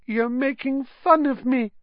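high, wounded accusation; tearful. A narrator's reading. Ghost girl suspects she is mocked, tearful. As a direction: accuse as tears well up. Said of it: "silver tears welling rapidly".

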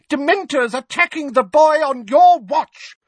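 high, very loud outrage; furious shout. A narrator's reading. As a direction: yell the accusation. Mrs Figg furious.

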